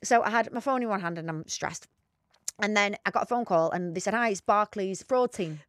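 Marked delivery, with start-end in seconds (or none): none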